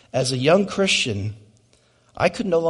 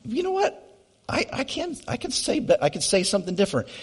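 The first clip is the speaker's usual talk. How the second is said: sharply higher, indignant comeback, speeding up at the end; mock defiant retort; 7 semitones higher, 6 dB quieter than usual. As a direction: bristle back like your pride was hurt. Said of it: "I'm like".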